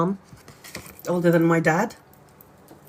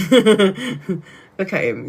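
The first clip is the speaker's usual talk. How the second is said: slow, laughing through the words; a real fond laugh; 2 semitones higher, 3 dB louder, much slower than usual. Real, not acted. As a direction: giggle as you show him off. Laughing at father's photo.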